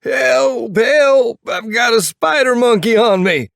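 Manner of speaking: very high, loud mock-panicked screams; screaming for help. A narrator's reading. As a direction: squeal for help in playful hysteria. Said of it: "Princess screams with laughter".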